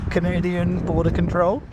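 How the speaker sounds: hammed-up Canadian accent; high mock Canadian voice. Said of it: "terrible Canadian accent".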